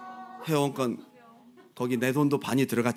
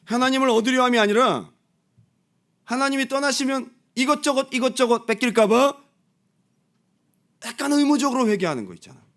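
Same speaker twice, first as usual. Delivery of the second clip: loud, high preaching; 9 semitones higher, 5 dB louder than usual. Acted. Pastor pressing his congregation, loud and high.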